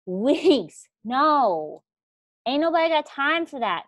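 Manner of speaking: loud, fed-up outburst; ain't nobody got time for that, sassy; fed up and done with waiting; exasperated. Real, not acted. Exasperated rant at slow prospects.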